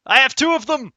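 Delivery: loud, high gleeful shout; triumphant shout. Real, not acted. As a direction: crow it out in triumph. Gleeful reveal of winning cards.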